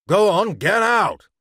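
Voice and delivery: loud, high order to clear off; demanding. A narrator's reading. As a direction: chase the kids away forcefully. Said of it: "he demanded".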